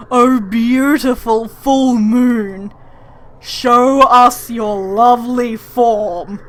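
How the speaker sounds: hammed-up grandiose call; grand address. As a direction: proclaim it to the moon like a showman. Character grandly addresses the moon.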